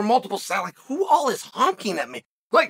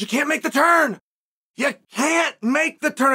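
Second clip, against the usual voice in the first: the driver insists you cannot turn, raised voice; 5 semitones higher than usual. Retold or imitated. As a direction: repeat the refusal, firm and raised. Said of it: "He says".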